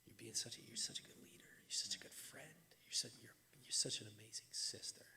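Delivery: gentle praising whisper to a daughter; tender whisper. Retold or imitated. Father praising his girl Melina, tender whisper. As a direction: whisper the praise close, forehead to forehead.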